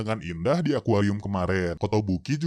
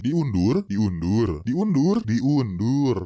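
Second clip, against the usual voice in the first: sing-song mocking repeat; mocking singsong; 3 dB louder, much slower than usual. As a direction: tease each word up and down. Mocking repetition of postponed.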